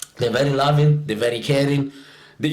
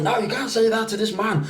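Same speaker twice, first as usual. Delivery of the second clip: shocked mother; 8 semitones higher, faster than usual. Retold or imitated. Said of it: "the mom was shocked".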